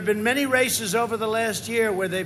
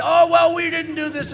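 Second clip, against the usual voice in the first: mock Biden; 8 semitones higher, 9 dB louder than usual. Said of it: "He's trying to say".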